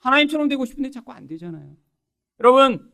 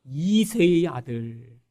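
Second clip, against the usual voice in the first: scornful naming; 5 semitones lower, 4 dB quieter, much slower than usual. Retold or imitated. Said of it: "뭐라고 불렀죠?".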